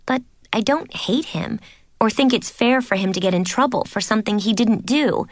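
ordinary delivery

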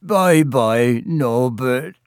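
sobbed farewell; tearful, doting goodbye; Hagrid. A narrator's reading. Hagrid parting from his dragon, sobbing. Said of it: "Hagrid sobbed".

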